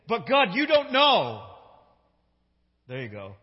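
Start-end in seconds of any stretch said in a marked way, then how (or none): 0.1-1.5 high, loud, slow objection; drawn-out, forceful outcry; emphatic protest. Acted. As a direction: drive each word home loudly. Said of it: "let me say that again".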